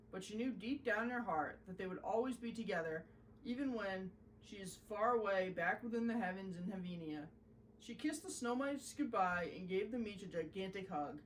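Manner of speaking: plain reading voice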